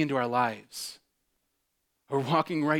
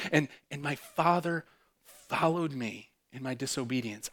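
normal talking voice

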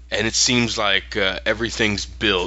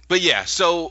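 normal talking voice

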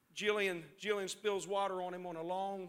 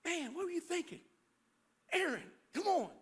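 mock self-scolding; 6 semitones higher than usual. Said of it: "look back at your life and say".

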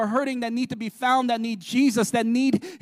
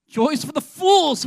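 scoffing slow quote, louder and higher; mock cynic; 5 semitones higher, 6 dB louder than usual. Preacher mocking cynics who scoff at joy. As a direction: sneer it like a cynic.

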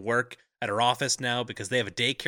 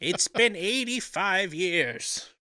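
put-on high, slow, drawn-out elderly reminiscence; old woman impression; 7 semitones higher, much slower than usual. Riffing on a Titanic line with co-host, hammed-up old woman. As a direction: stretch it out like an aged storyteller.

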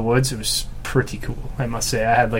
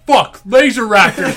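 higher, louder, slow thrilled exclamation; excited moviegoer; 9 semitones higher, 7 dB louder, slower than usual. Retold or imitated. Imitating a stranger behind him in the theater, loud thrilled outburst. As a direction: imitate a stranger losing it with joy in the cinema. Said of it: "This guy behind me's like".

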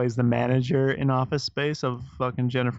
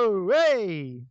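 put-on higher-pitched joke French-Italian accent; mock French accent; 6 semitones higher than usual. Playing up his bad Frenchman for laughs, mock French accent. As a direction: stretch the final vowel in mock French. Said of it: "then you throw in an A".

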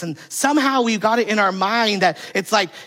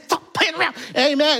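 much higher mock scold between amens; a hint of a stern old lady mid-service; great-grandmother scolding the child, then amen; 7 semitones higher than usual. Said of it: "I said".

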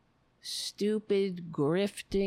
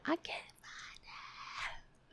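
a slow whispered mock scream; 9 semitones higher, 15 dB quieter, much slower than usual. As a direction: whisper it slowly like a stifled scream. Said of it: "And just start screaming".

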